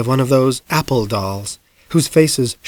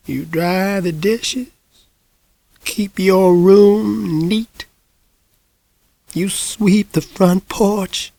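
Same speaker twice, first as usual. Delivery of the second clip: put-on higher, slow, half-whispered old lady; frail drawn-out voice of a very old woman; ancient old woman; 7 semitones higher, much slower than usual. A narrator's reading. Hundred-year-old lady talking to mother, frail and slow.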